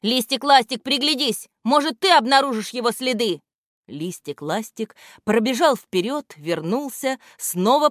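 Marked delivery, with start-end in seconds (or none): 0.0-3.4 calling. A narrator's reading. Gramotey calls to Listik.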